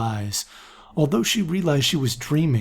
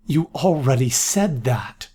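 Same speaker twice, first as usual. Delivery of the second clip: ordinary delivery, nothing marked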